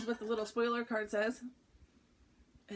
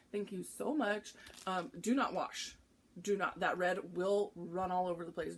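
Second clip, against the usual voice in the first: normal talking voice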